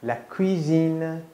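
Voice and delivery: La cuisine said very slowly, cui and sine drawn apart; teacher models La cuisine slowly for the student to copy; La cuisine spoken very slowly; slow French model. Shown as a demonstration.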